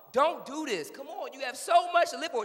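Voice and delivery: high, urgent plea; acted pleading. Child begs mom in the window, pleading high.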